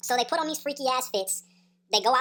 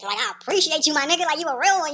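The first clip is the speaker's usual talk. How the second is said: louder, higher burst of gratitude; relieved loud thanks; 5 semitones higher, 7 dB louder than usual. Retold or imitated. Thanking Throat Goat for leaving him out, loud and relieved. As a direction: blurt your thanks in relief.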